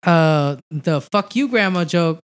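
ordinary delivery

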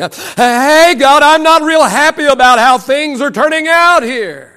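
driving sermon with acted-out complaints to God; impassioned preaching. Real, not acted. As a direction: preach it hard, pleading the case to God. Preaches, voicing frank prayers.

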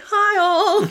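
very high, chirpy hello; mock cheery greeting. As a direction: greet the whole room in a high sing-out. Captain makes grand entrance, retold.

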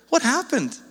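high, bewildered What happened, pitch lifted on What; bewildered outcry. Retold or imitated. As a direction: cry it out in disbelief at the church. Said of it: "And so he's like".

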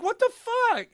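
very high incredulous yelp; retold outraged disbelief. Player just sold out by their best friend, high-pitched outrage. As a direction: squawk it in shocked outrage.

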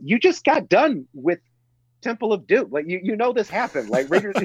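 high-pitched, incredulous protest; exasperated disbelief. Acted. Fan scolding Indy for doubting what he just saw, exasperated. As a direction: argue as if the proof is obvious.

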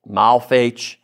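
"Malfach" in two syllables, mal-FAYCH; variant pronunciation. Shown as a demonstration. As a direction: end "Malfach" on a ch sound. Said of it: "I pronounce this, like, six different ways".

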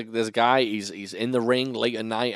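ordinary delivery

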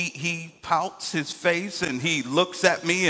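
ordinary talk, nothing marked

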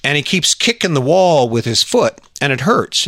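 normal talking voice

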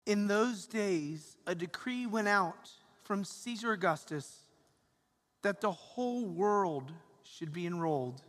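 proclaiming scripture from the pulpit; solemn, measured liturgical reading; Gospel reading. Acted.

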